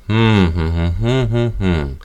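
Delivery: closed-mouth hums pulsing each syllable; voiced hum, one per syllable; humming the syllables. Shown as a demonstration. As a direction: hum the words as if gagged. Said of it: "So instead of".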